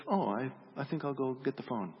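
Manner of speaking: casual, unbothered mock musing; nonchalant. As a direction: toss it off like nothing's wrong. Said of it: "sauntered as if".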